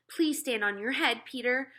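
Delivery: high, begging mother voice; mother begging her older son to help, pleading. Acted. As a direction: beg your son for help. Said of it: "my mother said".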